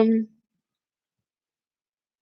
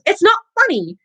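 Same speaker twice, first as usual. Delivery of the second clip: fierce; 7 semitones higher than usual. A narrator's reading. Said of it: "said Ron fiercely".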